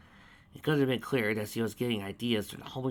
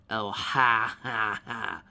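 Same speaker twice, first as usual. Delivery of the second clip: sarcastic laugh; 5 dB louder, much slower than usual. A narrator's reading. Applejack mock laughing back.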